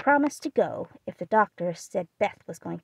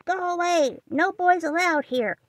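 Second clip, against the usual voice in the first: much higher, shrill parrot cry; squawking parrot; 9 semitones higher, 3 dB louder than usual. Acted. The parrot shooing Laurie off, squawking high. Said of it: "called out".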